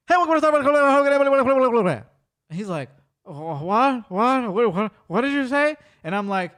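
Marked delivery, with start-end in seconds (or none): 0.1-1.9 loud, high, run-together gibberish on one note; garbled drive-thru speaker. Retold or imitated. Drive-thru worker greeting customers, garbled speed-talk. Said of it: "the guy talks like that". 3.2-5.8 higher, stammered repeated what; mock total bewilderment, rising to the question; baffled. Friend cannot understand drive-thru worker, baffled. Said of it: "Pastor is not understanding this woman".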